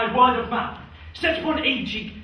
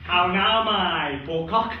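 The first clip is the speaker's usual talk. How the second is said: lower, slow mock tenderness; mock tender; 7 semitones lower, slower than usual. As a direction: coax him like a pet.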